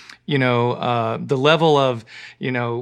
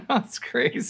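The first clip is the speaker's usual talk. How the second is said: laughing; 9 semitones higher, 6 dB quieter than usual. Real, not acted. Laughs in disbelief at story.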